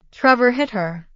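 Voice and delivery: ordinary delivery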